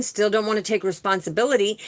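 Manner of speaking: ordinary talk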